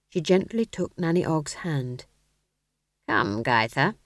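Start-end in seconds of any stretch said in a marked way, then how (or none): 3.1-4.0 gentle witch. Acted. Witch voice, low and far.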